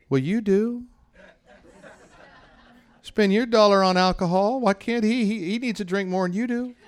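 mock indignation. Preacher sarcastically scolding the stingy listener, high and laughing. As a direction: needle the congregation with a squeaky mock outrage. Mocking the stingy giver's excuse.

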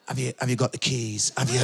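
low, subdued, halting request; imitating an embarrassed man who must come back; shamefaced. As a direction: ask sheepishly, tail between your legs. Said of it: "That is the walk of shame".

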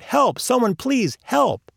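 high, stretched-out mock cry for help; calling out. A narrator's reading. Trapped man pleading with anyone passing, calling out. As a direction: plead for help, pitched well up. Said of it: "continues calling out".

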